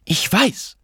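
high-pitched, angry retort; exasperated outburst without full shouting; irate cry. A narrator's reading. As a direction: cut him off indignantly, pitch jumping up. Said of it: "rief sie erbost".

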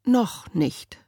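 ordinary delivery

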